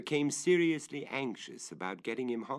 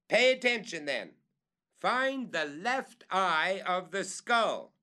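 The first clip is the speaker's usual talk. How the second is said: higher, louder, very slow order; calling up; 10 semitones higher, 6 dB louder, slower than usual. Acted. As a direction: spell out the instruction.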